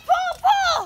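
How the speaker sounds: strained shouts while pulling; tug of war. Real, not acted. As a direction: yell it out mid-pull.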